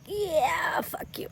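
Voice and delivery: whispered under the breath, trailing to nothing; quiet whispered brush-off. Retold or imitated. Imitating a host quietly quitting on his bosses, whispered brush-off. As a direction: mouth it quietly like a passive quiet quit. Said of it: "just sort of quietly being like".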